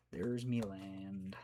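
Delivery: slow, drawn-out pirate talk; lightly played pirate, stretched slowly. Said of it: "Says the pirate".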